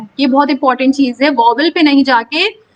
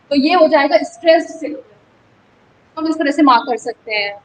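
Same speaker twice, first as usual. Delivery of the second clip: ordinary delivery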